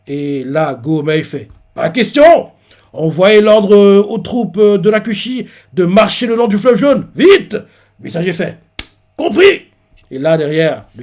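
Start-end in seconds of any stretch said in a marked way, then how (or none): 1.7-7.5 loud, higher barked order; commanding shout. Retold or imitated. Gomei orders troops urgently. 9.2-9.7 eager reply. Retold or imitated. Messenger acknowledges order.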